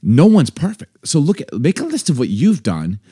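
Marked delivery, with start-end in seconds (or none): none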